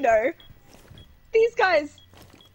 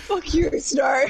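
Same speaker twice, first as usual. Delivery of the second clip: ordinary talk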